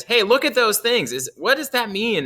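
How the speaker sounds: band director. Acted. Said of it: "until the band director says".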